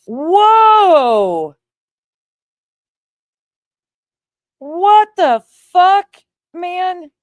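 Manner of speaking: very high, loud, slow drawn out whoa; shocked outburst. Real, not acted. Co-host reacting to a comment, loud shocked disbelief. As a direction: let the shock burst out high and long.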